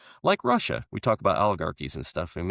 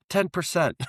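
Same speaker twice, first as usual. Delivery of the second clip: higher, proud little reveal; smug punchline; 10 semitones higher than usual. Retold or imitated. American politician confessing his cut, higher and smug. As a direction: deliver the skim like a secret. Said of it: "He's like".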